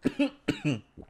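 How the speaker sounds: harsh choking cough; coughing fit. Real, not acted. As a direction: cough hard on swallowed coffee. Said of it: "I'm dying".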